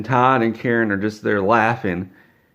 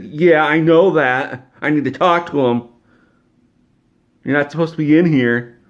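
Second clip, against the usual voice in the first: angry scolding; 6 semitones higher, 7 dB louder than usual. Retold or imitated. Karen scolds him angrily.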